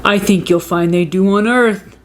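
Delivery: dad voice. A narrator's reading. Dad answers the Martian.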